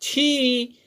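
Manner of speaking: छी with a long held ई; modelling a long छी. Shown as a demonstration. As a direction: stretch छी. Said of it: "तो हो जाएगा".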